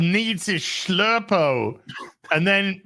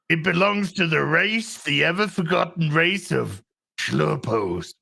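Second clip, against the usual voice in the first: ordinary talk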